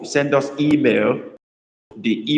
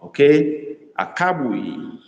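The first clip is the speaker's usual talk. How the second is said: ordinary talk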